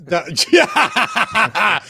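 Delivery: laughing. Real, not acted. Joking about liberal tears.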